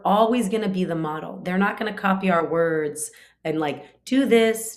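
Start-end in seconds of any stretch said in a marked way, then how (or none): 4.0-4.8 bossy command. Acted. Acted parental commands to child.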